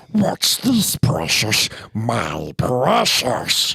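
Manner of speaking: whispered, then slow throaty creature voice; Gollum voice. Shown as a demonstration. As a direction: hiss the question, then savour it slowly. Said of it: "You go".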